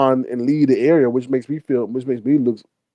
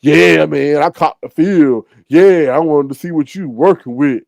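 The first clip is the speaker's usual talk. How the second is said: imitating the old man; 3 dB louder than usual. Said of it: "It would be like".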